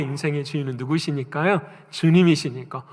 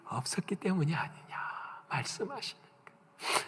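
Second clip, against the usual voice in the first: hushed rebuke; 2 semitones higher, 13 dB quieter than usual. Retold or imitated. God's rebuke drops to whisper.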